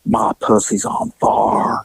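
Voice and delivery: low, slow whisper. Retold or imitated. Arrested woman whispering to the detective in the back seat. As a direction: confide it in a hushed whisper.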